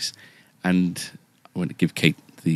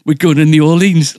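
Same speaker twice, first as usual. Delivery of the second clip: high and loud, bursting with the news; a wife's loud, high reveal of the surprise trip; excited announcement; 10 semitones higher, 10 dB louder than usual. Retold or imitated. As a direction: blurt it out thrilled. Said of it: "like that".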